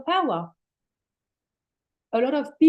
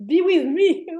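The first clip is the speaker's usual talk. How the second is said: laughing soul; 8 semitones higher, 9 dB louder than usual. Acted. Soul's invitation through laughter.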